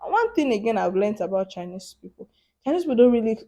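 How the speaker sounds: ordinary talk, nothing marked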